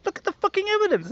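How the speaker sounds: very high, strained outburst; exasperated. Retold or imitated. Arguing with doubting friends, high exasperated outburst. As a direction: plead in disbelief, cracking upward. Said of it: "I'm like".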